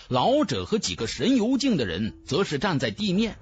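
ordinary delivery

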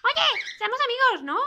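laughing, squeaky friend plea; eager bird voice. Acted. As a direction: gush like an overeager little bird.

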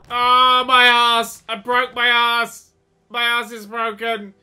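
loud, high, drawn-out lament; mock pained wail. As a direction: wail as if badly hurt. Character is hurt in game.

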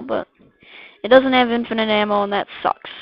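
ordinary delivery

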